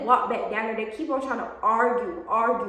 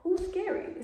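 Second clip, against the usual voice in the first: laughing mock; 7 semitones higher, 7 dB quieter, slower than usual. Real, not acted. Mocking the girls' claim.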